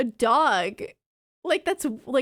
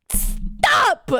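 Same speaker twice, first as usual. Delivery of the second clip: playful yell; 12 semitones higher, 4 dB louder than usual. Real, not acted. Scolding chat for dog rating.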